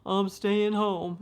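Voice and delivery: lightly voiced mother close to tears; imitating mom near tears. Mother refusing the family cruise, mildly tearful and pleading. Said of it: "she would almost get into tears and say".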